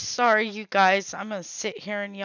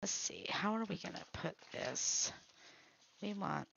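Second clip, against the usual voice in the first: whispering; 12 dB quieter than usual. Real, not acted. Talking to herself.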